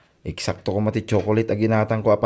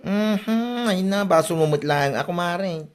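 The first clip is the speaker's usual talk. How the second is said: much higher, nagging comadre tone; high-voiced gossipy neighbour; 9 semitones higher than usual. Nosy neighbour chiding her kumare, high-pitched. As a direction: scold your kumare like a meddling neighbour.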